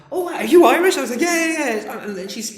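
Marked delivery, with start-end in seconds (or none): 0.1-1.0 high, loud delighted question; hammed-up thrilled recognition; retold excited surprise. Retold delighted midwife spotting his accent. 1.2-2.0 very high, loud mock yeses; squeaky, overexcited agreement; retold eager reply. Retold high-pitched reply mid birth.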